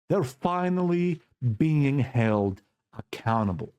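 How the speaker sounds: normal talking voice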